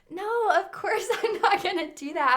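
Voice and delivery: high retold denial broken by a laugh. Retelling a promise not to tell a friend, laughing high protest. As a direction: deny it brightly, giggling midway.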